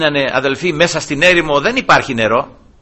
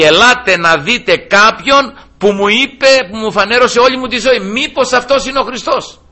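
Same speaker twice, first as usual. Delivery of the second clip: higher and louder, eager summons; excited calling out; 9 semitones higher, 4 dB louder than usual. Acted. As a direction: run to the town with the news. Woman calls the Samaritans excitedly.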